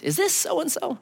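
put-on British accent. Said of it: "someone with a British accent".

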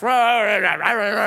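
loud, growly rah-rah scribble noise; mock scribbling noise. Man writing down the badge number, mock frantic noise. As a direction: imitate furious writing with nonsense syllables. Imitating furious scribbling of the ticket.

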